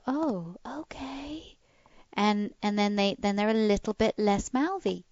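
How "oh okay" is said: slow high whisper; hushed, slow whispered "Oh, okay"; whispered dog reply. Retold or imitated. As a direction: breathe it out like a calmed dog. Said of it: "make them go".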